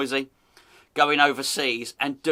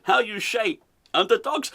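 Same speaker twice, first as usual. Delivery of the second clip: put-on higher, slushy-S Dutch accent; mock Dutch accent; 5 semitones higher than usual. Host quoting manager to interviewer, mock Dutch accent. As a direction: ask it in fake Dutch-English. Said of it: "He's speaking in a Dutch accent".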